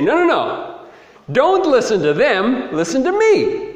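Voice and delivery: high, emphatic protest; Paul. Retold or imitated. Paul answering his false accusers, insistent high-pitched protest. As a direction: plead the case insistently, pitched right up. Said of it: "And so now Paul has to say".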